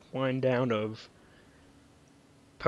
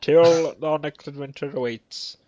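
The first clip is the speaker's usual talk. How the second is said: announcer voice; 3 semitones higher, 8 dB louder than usual. Acted. Outro announcer style.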